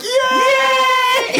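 very high squealing cheer, laughing; cheering kids. Retold or imitated. Friend mimics orphans cheering, high laughing squeal. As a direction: squeal like delighted children. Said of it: "They're like".

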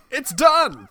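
high, loud comic exclamation; hammed-up jubilant cry; mock triumphant announcement. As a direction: call it out like a punchline. Joking punchline called out.

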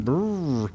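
chainsaw noise. Acted. Chainsaw zombies approaching.

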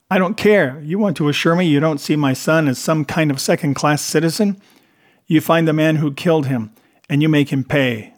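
forceful, wounded insistence; angry demand. A narrator's reading. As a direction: cut in hard and demand justice. Mother cuts him off, demands justice.

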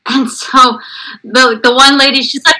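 usual speaking voice

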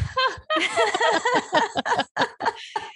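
long, high burst of laughter; real laughter. Real, not acted. Psychic recalling an awkward question to a client, laughing hard. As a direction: crack up at your own story.

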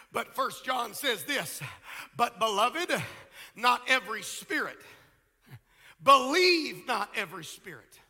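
loud, driving pulpit delivery; full-force preaching. Real, not acted. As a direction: hammer key words and stretch them out.

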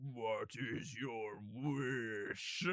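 slow, low booming dragon voice; genie. Pretend. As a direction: draw out each word like an ancient dragon. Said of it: "he's gonna be like".

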